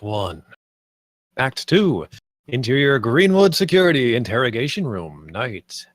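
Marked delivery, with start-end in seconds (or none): none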